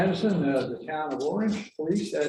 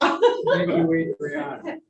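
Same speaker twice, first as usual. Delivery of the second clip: laughing; 12 semitones higher, 3 dB louder, much slower than usual. Real, not acted. Thanks her after group laughter.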